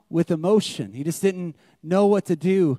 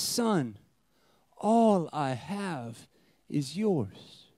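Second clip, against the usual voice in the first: soft, slow fatherly tenderness; compassionate father; 3 semitones lower, 6 dB quieter, much slower than usual. Retold or imitated. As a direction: say it gently, as a loving father would. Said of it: "out of compassion, he says".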